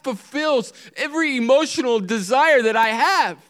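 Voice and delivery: high, loud, near-tearful disbelief; crying out in amazed wonder at being chosen; overwhelmed preaching. Real, not acted. Preacher marvels that God chose him, voice high, loud and breaking.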